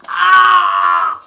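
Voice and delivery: sudden high-pitched yell; loud scream. Real, not acted. Tired vlogger fed up with stumbling over words, loud scream.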